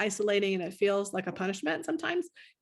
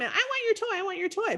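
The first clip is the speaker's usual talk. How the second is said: put-on much higher childish whine of "I want your toy"; yelling child; 12 semitones higher than usual. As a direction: squeal "I want your toy" like a grabby kid. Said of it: "maybe they were yelling".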